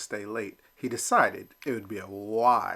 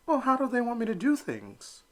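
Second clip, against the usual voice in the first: put-on a child not understanding; puzzled little duckling; 12 semitones higher than usual. Elmer asks Mama.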